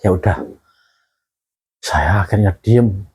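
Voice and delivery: low, halting voice with long pauses; close to tears. Real, not acted. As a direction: hold back real tears while remembering. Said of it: "ini saya ceritain sampai kepingin nangis saya". (suara rendah menahan tangis)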